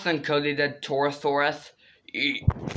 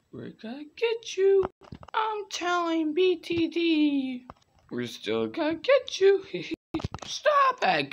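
put-on squeaky kid voices; 13 semitones higher than usual. Kids' chase game, high squeals.

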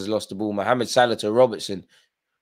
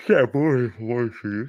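talking through a yawn; words stretched by a yawn; slower than usual. Real, not acted. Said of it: "Sorry, I'm just yawning up the place".

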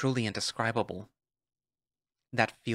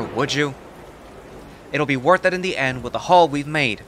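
annoyed; 4 semitones higher, 7 dB louder than usual. Acted. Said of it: "Alverton looks down with an annoyed face".

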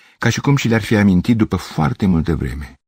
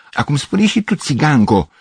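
high-pitched, insistent call; crying out; 8 semitones higher than usual. A narrator's reading. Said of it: "strigă Grecoaica".

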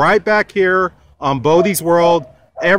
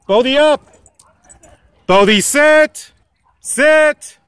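raised, firm commands to a dog; 5 semitones higher than usual. Real, not acted. Giving dog commands firmly.

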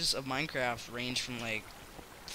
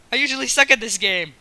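loud, higher gamer yell; excited yelling; 6 semitones higher, 13 dB louder than usual. Real, not acted. Gamer amazed during a fight, excited yelling. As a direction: shout it mid-fight.

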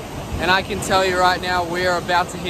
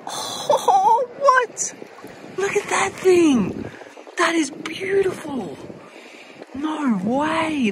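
high-pitched shrieks of delight, slowed on the big words; real giddy delight, voice jumping way up; excited squeal; 10 semitones higher, slower than usual. Real, not acted. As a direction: squeal at the find of a lifetime. Thrilled find of a rare shell.

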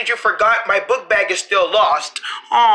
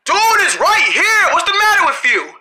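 exasperated shout; 7 semitones higher than usual. Acted. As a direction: yell it at him, fed up. Frustrated kid calling out.

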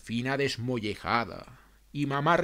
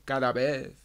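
plain reading voice

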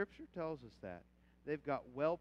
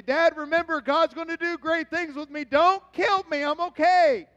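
high, loud desperate begging; pleading son; 22 dB louder than usual. Retold or imitated. Son pleading with his angry dad, loud and frantic. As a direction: beg Dad not to punish you. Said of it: "I wanted to tell Dad".

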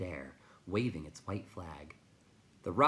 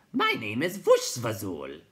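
loud, slow, raised creature voice; hooting creature; 4 semitones higher, 9 dB louder than usual. A narrator's reading. Night Hob introduces himself, loud and slow. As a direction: announce yourself loud, drawing out the name.

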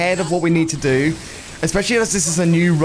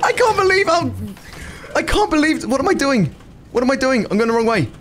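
frantic excitement; 10 semitones higher than usual. Real, not acted. Streamer going the wrong way, high frantic laugh-shouting. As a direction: lose it in delighted panic. Streamer panicking mid-run.

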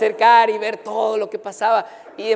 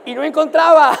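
laughing; 8 semitones higher, 8 dB louder than usual. Real, not acted. Laughing at failed search.

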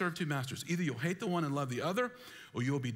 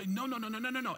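high-pitched mock dismay; old client watching stocks crash, hammed-up panic; mock panicked repeats; 8 semitones higher, faster than usual. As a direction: cry no over and over like losing money.

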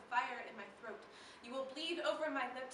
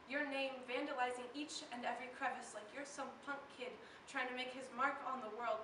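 usual speaking voice

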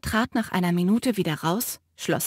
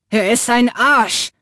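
angry; 7 dB louder than usual. Acted. Matteo cuts Finn off angrily.

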